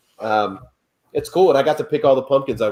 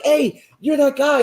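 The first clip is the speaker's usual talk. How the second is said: higher, slow, imitating an excited fan; stranger recognizing; 12 semitones higher than usual. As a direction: draw it out like spotting a celebrity. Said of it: "He's like".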